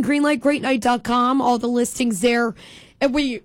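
ordinary talk, nothing marked

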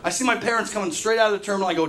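ordinary delivery

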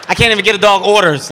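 ordinary talk

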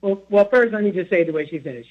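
ordinary delivery

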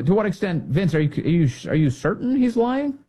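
ordinary talk

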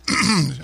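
clearing a frog from the throat; quick cough to clear the throat. Real, not acted. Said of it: "I got a frog in my throat here".

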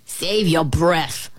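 usual speaking voice

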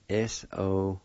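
letter by letter, long pauses; spelled out. Shown as a demonstration. Teacher spelling a word for learners, spelled out slowly. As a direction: spell it slowly in small groups. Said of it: "You spell this phonetically".

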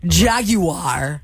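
Jaguar as "JAG-you-ar"; required ad pronunciation. Shown as a demonstration. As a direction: say Jaguar in three drawn-out syllables. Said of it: "ways we've had to say things".

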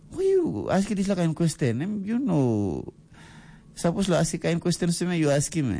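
a lightly voiced indignant father; shocked father. Father shocked at son's question.

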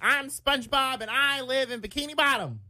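high, chipper cartoon voice; SpongeBob. Shown as a demonstration. Impression for co-hosts, high heroic cartoon voice. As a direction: pitch it up and declare it proudly. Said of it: "This is my SpongeBob impression".

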